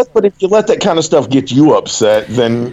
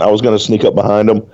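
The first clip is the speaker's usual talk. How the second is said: usual speaking voice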